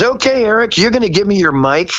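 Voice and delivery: high, bright host voice; cheerful TV host handoff; imitating Dick Clark. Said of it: "he goes".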